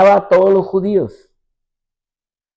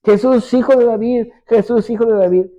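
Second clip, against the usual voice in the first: high pleading call to Jesus; a desperate woman calling after Jesus in the crowd; imitated crying out; 7 semitones higher than usual. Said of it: "y le voy a gritar".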